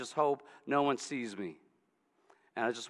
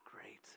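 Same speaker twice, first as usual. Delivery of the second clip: annoyed whispered sigh; 25 dB quieter than usual. Acted. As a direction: sigh then whisper great. Woman sighs at the stranger.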